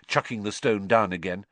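usual speaking voice

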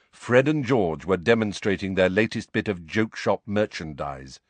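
ordinary delivery

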